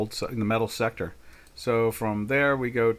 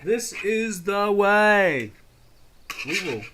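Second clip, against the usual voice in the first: higher, slow, drawn-out solemn creed; Mandalorian creed; 8 semitones higher, slower than usual. Retold or imitated. Quoting the Mandalorian creed, slow and solemn. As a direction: intone it like a sacred oath. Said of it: "It's kinda like, uh, the Mandal-, Mandalorian".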